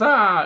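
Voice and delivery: short, high, loud mock shriek; mock startled scream. Heroine jumps as the door shuts, mock scream. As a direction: yelp as if startled, playfully.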